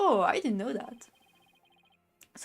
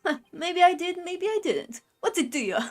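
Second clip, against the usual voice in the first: put-on Brooklyn accent; 8 semitones higher than usual. Justice minister to the lawyer who asked, cagey Brooklyn accent. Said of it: "a Brooklyn accent".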